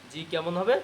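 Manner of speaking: normal talking voice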